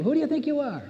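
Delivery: a sneering high-pitched dare; the man sneering at the woman, high and mocking; mocking challenge. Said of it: "the man was asking the woman".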